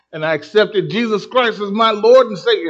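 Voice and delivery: excited testimony. Retold or imitated. Curator retelling her conversion to the crowd, excited and loud. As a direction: proclaim it with joy. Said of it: "She came alive".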